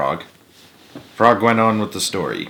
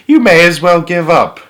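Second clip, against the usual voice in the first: taunting robins; 7 semitones higher, 6 dB louder than usual. Acted.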